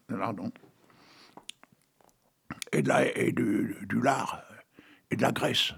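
whispered aside. Acted. Listing pig fat.

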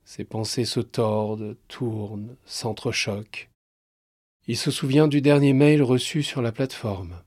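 usual speaking voice

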